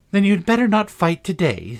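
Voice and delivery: put-on high, eager girl's suggestion; lightly girlish, emphatic advice; peacemaking Alice. A narrator's reading. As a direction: urge peace in a loud, lifted voice. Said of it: "thinking it was a good opportunity to make peace".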